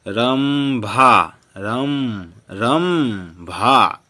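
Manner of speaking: रंभा very slow, the m hummed long in each go; drawn-out pronunciation. Shown as a demonstration. Teacher has pupils read along, रंभा slowly drawn out. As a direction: stretch रंभा and रम, holding the m. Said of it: "हमरे साथ पढ़िए guys".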